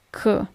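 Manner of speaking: "k" as an isolated hard consonant; hard k sound. Shown as a demonstration. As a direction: sound out "k" on its own. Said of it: "the sound is always".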